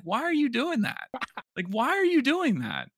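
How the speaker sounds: high, incredulous pitch climbing; exasperated disbelief. Real, not acted. Ranting to co-host about the player's choices, high exasperated disbelief. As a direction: pile on the disbelief, voice going way up.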